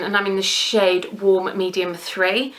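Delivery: usual speaking voice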